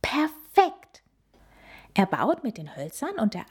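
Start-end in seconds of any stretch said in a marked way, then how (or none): 0.0-1.0 high delighted exclamation; excited child. Acted. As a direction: cheer it out, thrilled with the plan.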